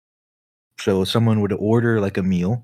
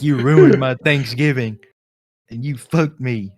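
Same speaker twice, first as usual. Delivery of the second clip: higher, louder, hammed-up indignant complaint; mock outrage; 3 semitones higher, 4 dB louder than usual. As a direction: play the wronged regular, overdone. Upset customer retold.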